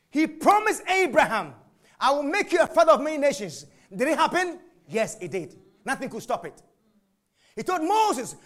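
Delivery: high, loud preaching; full-force preaching. Acted. Preacher to congregation, fervent loud preaching. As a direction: build the fire and preach.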